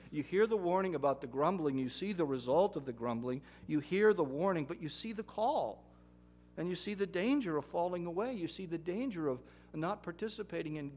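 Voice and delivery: loud, rising preaching cadence. Acted. Preacher urging the congregation, loud and forceful. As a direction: drive home each warning.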